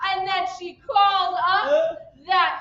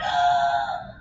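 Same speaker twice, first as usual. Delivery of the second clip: high, drawn-in gasp; dramatic stage gasp; shocked gasp; 13 semitones higher than usual. Acted. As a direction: gasp at a horrible discovery. Character opens the food.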